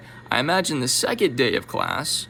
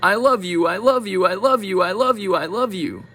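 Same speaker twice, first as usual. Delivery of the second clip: cat's message; 4 semitones higher than usual. Retold or imitated. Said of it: "what if he's actually saying".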